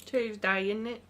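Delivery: put-on light playful British accent. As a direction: slip into a hint of British accent. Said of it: "I'll stop talking in a British accent".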